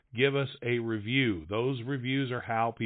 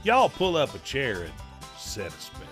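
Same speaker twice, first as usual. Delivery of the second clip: big hillbilly announcer voice over music; show intro announcer; 4 semitones higher than usual. Acted. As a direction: announce the show like a country radio host.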